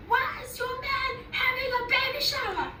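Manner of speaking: high, half-sung taunting question; sing-song reveal. Retold or imitated. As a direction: sing the gossip out.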